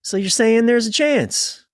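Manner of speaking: high, hopeful squeaky voice; a high, eager hope against the odds; a partner hearing the ten-year news, squeaky with hope; hopeful mock voice. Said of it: "you're like".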